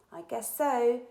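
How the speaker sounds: ordinary delivery, nothing marked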